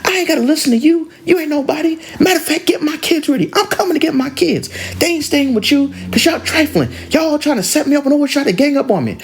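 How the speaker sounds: very high-pitched, defiant mock rant; cursing him out. Angry daughter cursing out her dad on the phone, defiant. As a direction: imitate a daughter telling her father off. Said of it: "she cursing out her dad".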